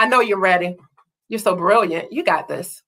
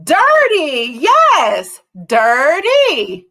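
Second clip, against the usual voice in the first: excited praise; 6 semitones higher, 3 dB louder, much slower than usual. Real, not acted. Praising answer.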